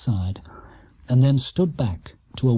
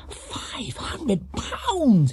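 very slow, higher, hushed in awe; awed; 12 semitones higher, 3 dB quieter, much slower than usual. A narrator's reading. Judy awed by the prize, hushed and very slow. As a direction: breathe the sum out in wonder. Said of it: "in a tone of awe".

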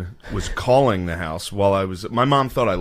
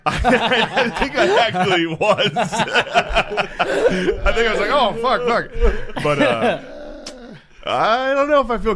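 words broken up by laughter; laughing; 10 semitones higher, much slower than usual. Real, not acted. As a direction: laugh through the line. Laughing while retelling.

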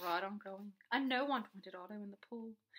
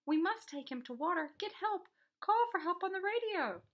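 high, loud, pressing orders; raised, insistent storybook voice; urgent plea; 8 semitones higher, 6 dB louder than usual. A narrator's reading. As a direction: urge the rescuer into action. Boy urges policeman to get help.

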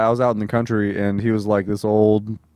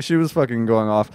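normal talking voice

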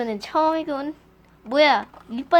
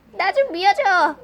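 normal talking voice

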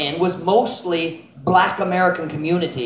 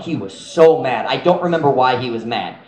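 usual speaking voice

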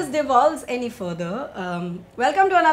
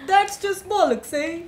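slow, high put-on British accent with a tag question; a drawn-out fake British "bollocks"; mock British accent; 6 semitones higher, much slower than usual. Friends joking about British slang, in a slow, high mock British accent. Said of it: "what do British people say?".

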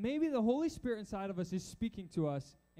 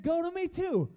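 raised, louder and high with delight; excited retelling; 8 semitones higher, 5 dB louder than usual. Retold or imitated. Telling the congregation, husband voices his wife's excited answer. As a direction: burst out with the shared news.

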